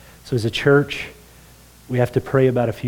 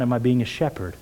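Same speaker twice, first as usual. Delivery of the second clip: normal talking voice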